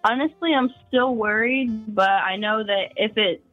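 ordinary talk

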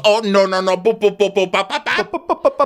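high, loud put-on babble; mock shushing in popping nonsense syllables; Diana. Host imitating Diana cutting Sutton off, high mock protest. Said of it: "And she goes".

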